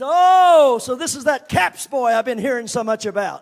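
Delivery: put-on loud sardonic old man voice; mocking uncle. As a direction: size the boy up with scorn. Uncle sizes up the boy.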